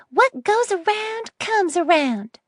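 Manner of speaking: put-on high, slow hissing old woman; hissed. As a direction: hiss it out with spite. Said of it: "she hissed".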